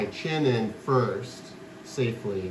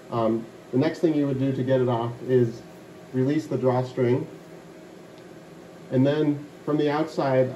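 muffled through enclosed head box; 2 semitones higher than usual. Real, not acted.

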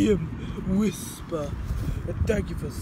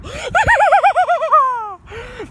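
very high, loud shrieking laugh; wild laugh; 9 dB louder than usual. Acted. Love-giving yokai erupts, wild laugh. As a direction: let out a long shrieking cackle.

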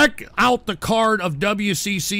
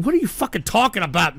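usual speaking voice

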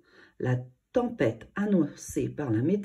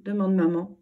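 usual speaking voice

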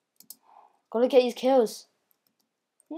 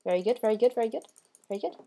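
usual speaking voice

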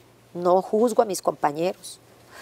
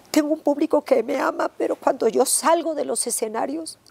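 much higher, anguished retold crying out; woman crying out to God in anguish from her loneliness; desperate plea to God; 8 semitones higher than usual. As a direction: relive begging God in despair, high and strained. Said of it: "empecé a gritarle a Dios".

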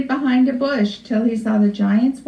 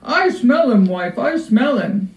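giant crying out. A narrator's reading. Giant insists to his wife, crying out. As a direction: cry out, then let it fall. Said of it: "cried out the giant".

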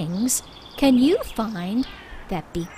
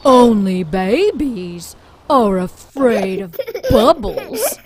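said through laughter, louder; giggling comeback to the bully; laughing taunt; 4 dB louder than usual. Acted. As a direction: tease her while still laughing. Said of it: "Bear told Badger".

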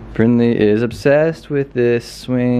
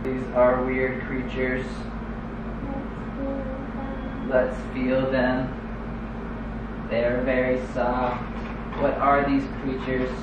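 alien robot voice; 9 dB quieter than usual. Pretend. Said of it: "She is an alien robot".

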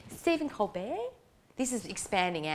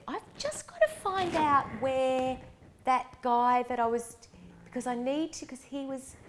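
mock excuse; 7 semitones higher than usual. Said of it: "that I said".

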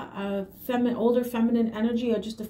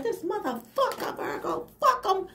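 high, partly whispered mock cursing; mock cussing out; 9 semitones higher than usual. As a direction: cuss them out in a high, hushed voice. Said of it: "getting ready to cuss them out".

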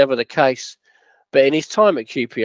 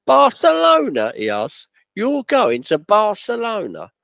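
high, slow, drawn-out incredulous question; mocking disbelief; 9 semitones higher, slower than usual. Chairman scoffing at the Barcelona interview, slow mock disbelief. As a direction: stretch it out as if it's a joke.

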